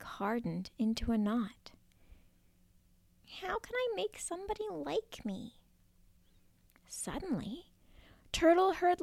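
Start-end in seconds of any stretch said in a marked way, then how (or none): 3.4-5.6 anxious hush. Acted. Turtle anxious.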